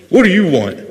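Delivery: hammed-up impatient parent; fed-up spouse mode. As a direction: bark it like you're fed up at home. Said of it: "how we talk to our kids and our spouses mode".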